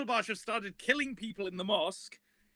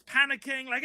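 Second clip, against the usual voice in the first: ordinary talk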